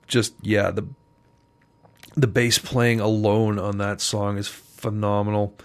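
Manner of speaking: ordinary talk, nothing marked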